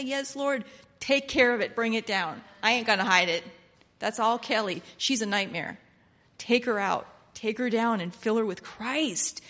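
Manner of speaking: loud, driving sermon prayer; preaching at full force. Acted. Preacher crying out to the Lord, loud and driving. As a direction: preach it out to the church.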